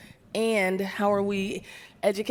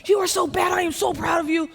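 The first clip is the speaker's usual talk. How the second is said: gushing excitement; 11 semitones higher than usual. Retold or imitated. Woman gushing at the female Marine pilot, excited and high. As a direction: squeal over the Marines like a starstruck fan. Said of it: "She's like".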